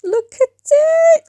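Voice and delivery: very high, slow, drawn-out squeal; squealed look at it. Pretend. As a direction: stretch it into a thrilled high squeal. Showing off the painting progress.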